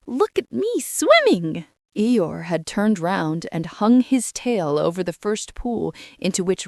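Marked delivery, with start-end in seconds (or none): 0.1-1.6 put-on much higher, proud squeaky child; squeaky Roo. A narrator's reading. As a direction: squeak it out while bobbing in the pool. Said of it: "was answering".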